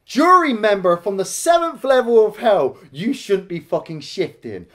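ordinary talk

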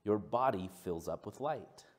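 slow, measured reading with long pauses; scripture reading. Acted. Pastor opens his sermon with a passage, slow reading. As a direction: read it out deliberately, phrase by phrase.

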